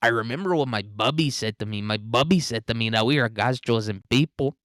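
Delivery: lilting mock Mexican accent, swooping up and down; caricatured sing-song Mexican accent. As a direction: do a hammed-up Mexican accent, lifting the last word. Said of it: "That's what Sheinbaum says to us".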